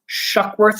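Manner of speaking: plain reading voice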